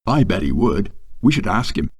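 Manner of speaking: loud, teasing stage voice; talking loudly. Acted. First mate teasing the new guy across the table, loud and theatrical. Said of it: "started talking quite loudly across me".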